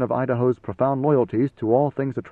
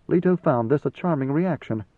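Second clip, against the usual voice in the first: plain reading voice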